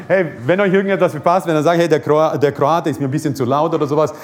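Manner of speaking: ordinary talk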